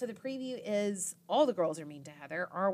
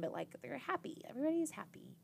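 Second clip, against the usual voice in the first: ordinary talk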